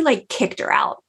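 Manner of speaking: normal talking voice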